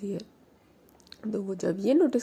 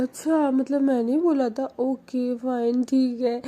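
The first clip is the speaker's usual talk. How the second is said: sulky whine; 5 semitones higher, 5 dB louder than usual. Acted. Mani sulks.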